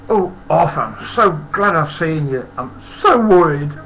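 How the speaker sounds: high, loud, overwrought mimicry; imitated panicky high-pitched pleading; worried Huey. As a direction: wail it out high as a fretting workmate. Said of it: "And Huey came dashing up".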